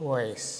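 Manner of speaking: వాయిస్ quietly, 'oy' with a soft 'sh'; German pronunciation. Shown as a demonstration. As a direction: say వాయిస్ with 'oy' and a hushed ending. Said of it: "అలాగే పలుకుతారు".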